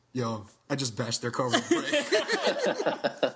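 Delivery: slow, giggling admission of mischief; drawn-out laughing brag; laughing confession. Retold or imitated. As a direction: laugh through the words like a proud prankster. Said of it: "my friend Noah goes".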